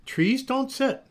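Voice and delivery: put-on high, emphatic child's insistence; stubborn insistence. A narrator's reading. Noelle to mother at dinner, stubborn high insistence. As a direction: insist on it, refusing to budge.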